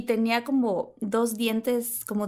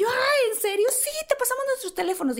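high, fast gushing; eager to swap numbers; mock girlish excitement; excited chatter; 13 semitones higher than usual.

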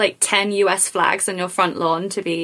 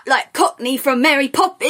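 higher, exaggerated stage Cockney; mock Cockney accent; 5 semitones higher than usual. Mocking North Americans trying British, mock Cockney accent. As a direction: overdo a bad Cockney accent. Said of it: "try and do the British accent".

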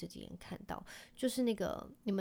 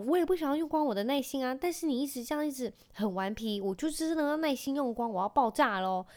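higher and louder, quick frustrated mom; exasperated scolding; 5 semitones higher, 4 dB louder than usual. Retold or imitated. Mom tells son her patience is running out, fast and exasperated. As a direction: rattle it off like you're at the end of your rope.

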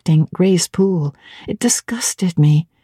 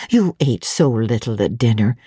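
motherly housekeeper; 6 semitones lower than usual. A narrator's reading. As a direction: speak as the fussing elderly housekeeper. Fairfax voiced in audiobook.

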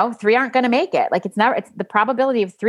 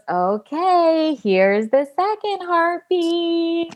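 high, slow, announcing voice; sing-song reveal; 9 semitones higher, much slower than usual. Retold or imitated. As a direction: draw out each word like a big reveal. Ultrasound tech announcing heartbeats.

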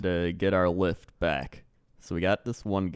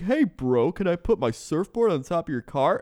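higher, easygoing bro request; lightly voiced surfer bro; 7 semitones higher than usual. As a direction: ask like a hopeful surfer dude. Driver mimics surfboard-carrying Skyler.

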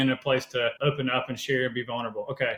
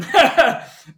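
a sudden high, loud burst of laughter; imitated group laughter; 13 semitones higher, 10 dB louder than usual. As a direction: mimic the guys laughing it off. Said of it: "they kinda went".

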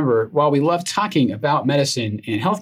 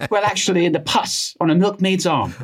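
mock RP correction, pitched up; higher-pitched, hammed-up Received Pronunciation; put-on RP accent; 6 semitones higher than usual. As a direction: correct a heckler in attempted Queen's English. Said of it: "pulling in this R P accent".

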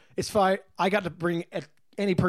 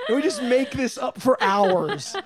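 laughing; 4 semitones higher, 3 dB louder, slower than usual. Real, not acted. Laughing through the memory.

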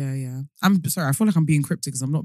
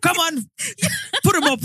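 mock brawler challenge; 13 semitones higher than usual. Imitating Irish fighters.

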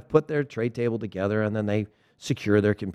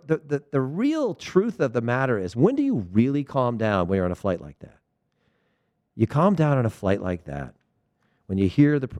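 usual speaking voice

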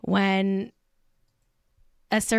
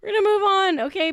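much higher and louder insistence; higher, louder "We're gonna move on" to cut off the rant; insisting; 11 semitones higher, 4 dB louder, faster than usual. Shown as a demonstration. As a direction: push the topic change through forcefully. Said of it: "I'm done ranting".

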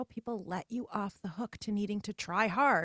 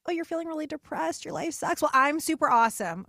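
higher, getting louder into a gloating "super awesome"; mock smug brag; 7 semitones higher, 6 dB louder than usual. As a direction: play the oblivious show-off, tongue in cheek. Mocking a smug friend.

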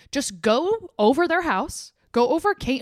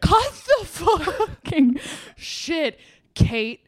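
slow mock threat; much slower than usual. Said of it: "Meghan confronting Kate".

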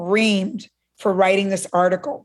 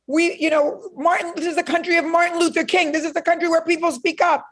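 put-on defiant teen; 8 semitones higher than usual. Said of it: "I go".

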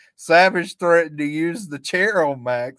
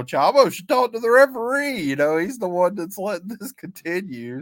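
raised pitch, mock indignant complaint; instigating; 6 semitones higher than usual. Co-host quoting Larry stirring up trouble over the referee, high-pitched instigating. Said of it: "Larry's kinda instigating that".

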